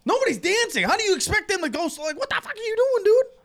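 high-pitched, incredulous scolding; retold outburst, voice shooting up high; exasperated mock rant. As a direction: rant at a clueless DJ. Acts out scolding the DJ.

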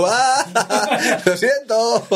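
high, giggling sorry; laughing apology. Retold or imitated. He laughs as he apologises to the angry tech.

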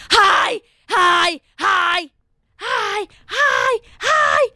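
high-pitched mock yell, repeated; scream out the window. Student hollering out the window at touring moms, high mock scream. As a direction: call "hi" over and over in a high, strained yell. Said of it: "I scream out my roommate's window".

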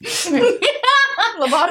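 high, slow laughing word. Real, not acted. Laughing, drawn out.

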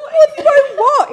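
loud, high, drawn-out laughing question; retold shock. Sister turns round to brother in car, mock shock. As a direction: cry it out in mock bewilderment.

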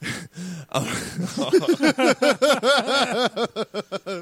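high, sustained cackle; helpless high-pitched laughter; long laughing fit. Real, not acted. Hosts cracking up at the movie confession, long high laugh.

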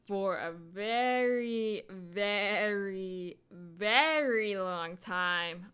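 "very, very, very" long and drawn, leaning hard on each; slow, exaggerated stress on every "very"; drawn-out emphasis. Real, not acted. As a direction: stretch each "very" out slowly.

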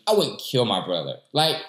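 normal talking voice

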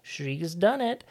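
high, slow hammed-up nerdy cheer; squeaky mock triumph, very slow; mock nerd voice. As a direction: imitate a proud nerd shouting eureka. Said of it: "this fucking Rivet nerd says".

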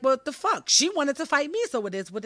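justifying the fight to herself, indignant. Retold or imitated. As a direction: defend yourself, exasperated. Retold inner indignant justification.

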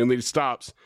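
usual speaking voice

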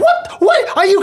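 very high, squeaky disbelief; mock shock. Crew watching the planet slowly die, shocked. Voicing shocked onlookers in retelling.

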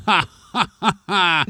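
mocking ha ha laugh at a hurt man; hammed-up mocking laughter. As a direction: jeer with a fake laugh. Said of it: "someone's laughing at him, going".

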